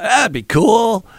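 high, slow, far-off dreamy voice; naive buyer voice. Retold or imitated. Imagining a big cat buyer, dreamy voice. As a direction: play the dreamy buyer who thinks it's cool. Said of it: "you're like".

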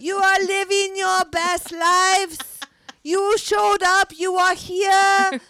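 put-on slow, high, loud French-accented cheer; gushing French coach praising her students; French teacher's accent. Teacher cheering her swim students in a French accent. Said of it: "my French accent's getting better 'cause she'll be like".

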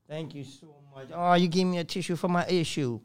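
hushed, emotional thanks; tearful. Real, not acted. Man thanking friend for a tissue, softly tearful. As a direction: speak softly after crying. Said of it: "I'm a sensitive dog out here".

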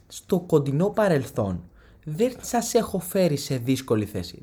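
slow, weighty words; mock-solemn address to relatives; mock speech. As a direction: deliver it like a solemn declaration. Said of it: "Και έχω να πω".

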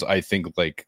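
ordinary delivery

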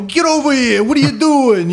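put-on high-pitched New York street accent. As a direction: holler like a pushy New Yorker. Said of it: "it's a very pronounced, you know, different accent".

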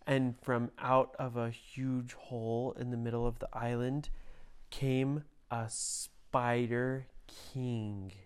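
usual speaking voice

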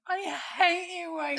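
put-on high, petulant child; sulky little voice. Inner child sulking at her adult self, high petulant. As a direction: sulk like a grumpy kid. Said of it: "this little voice is going".

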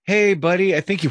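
put-on high, loud "hey, buddy" to a kid; mock parent address. Parent flagging a problem to a kid, mock-concerned.

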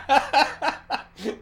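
loud giddy laugh; high burst of laughter. Real, not acted. Laughing at quote.

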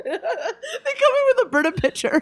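high laughing line. Real, not acted. Laughing while adding to the Brita joke.

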